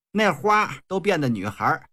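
ordinary delivery

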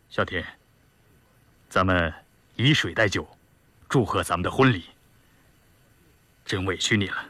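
low, heartfelt bridegroom toast; halting, low-voiced sincere gratitude, long pauses; rough soldier, tender. A narrator's reading. Groom's tender wedding-night toast.

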